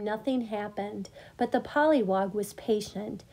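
ordinary delivery, nothing marked

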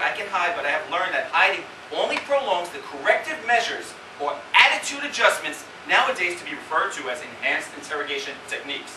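ordinary talk, nothing marked

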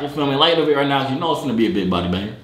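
ordinary talk

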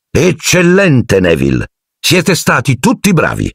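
animated, high-pitched teacher; delighted praise. A narrator's reading. (professore entusiasta che premia)

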